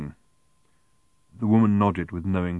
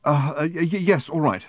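higher, stammering assent; flustered, hurried; 8 semitones higher, 3 dB louder than usual. A narrator's reading. Arthur giving in to the ticket seller, flustered. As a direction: stumble into a hasty agreement. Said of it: "hurriedly digging in his pocket".

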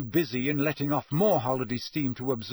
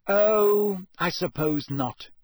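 resigned sigh of agreement; 5 semitones higher, 5 dB louder, slower than usual. A narrator's reading. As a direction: give in with a small sigh. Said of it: "she sighed".

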